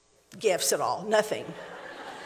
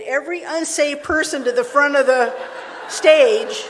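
excited build-up; 4 semitones higher, 8 dB louder than usual. Real, not acted. Building story climax.

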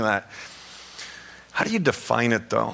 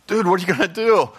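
raised, higher excited yell at the screen; 7 semitones higher, 4 dB louder than usual. Retold or imitated. Pastor retelling yelling at an eagle on TV, excited yell. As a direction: shout at the eagle like a sports fan. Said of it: "I'm yelling at the T V".

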